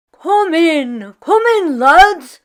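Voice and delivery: high, loud come-on-in call; hearty welcome. Acted. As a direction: welcome the lads with open arms. Jamie welcoming his friends.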